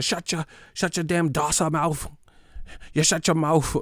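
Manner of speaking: high mock British snap; mock British fan. Mocking a British fan shutting down a critic, high mock accent. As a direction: tell him to shut up like a hammed-up Brit. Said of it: "I thought you liked knockout".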